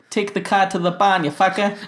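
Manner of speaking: loud, high-pitched Boston tough guy; Boston accent. Retold or imitated. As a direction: yell it in a thick Boston accent. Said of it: "at a Boston accent".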